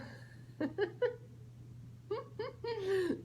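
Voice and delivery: soft giggle after the reveal; quiet delighted laughter; amused laugh. Real, not acted. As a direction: chuckle at the crocodile. Laughs at crocodile answer.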